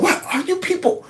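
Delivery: high-pitched, incredulous questioning; imitated bafflement. Voicing the guest's natural reaction to the propagandists, baffled. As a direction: mimic someone who cannot make sense of this. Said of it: "she has a natural reaction".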